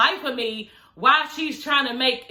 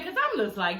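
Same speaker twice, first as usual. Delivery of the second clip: ordinary delivery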